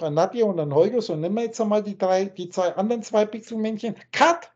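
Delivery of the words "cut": sharp, high call of cut. Acted. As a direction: call it like a film director. Ruft mitten im Gedankenspiel Schnitt. (lauter, hoher Ausruf)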